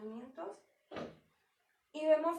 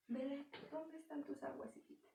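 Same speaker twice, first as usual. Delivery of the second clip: much softer, gentle and coaxing; tender fatherly voice; 9 dB quieter than usual. Acted. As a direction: sweetly ask a child where her waters are. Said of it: "tal vez nosotros cambiamos la voz".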